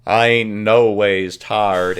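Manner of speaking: very slow put-on drawl; mock drawl. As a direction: drag it out in a mocking drawl. Mock quote in exaggerated drawl.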